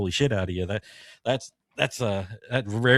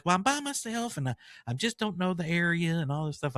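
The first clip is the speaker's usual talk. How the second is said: imitating the lady; 7 semitones higher, 4 dB quieter than usual. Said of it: "She's like".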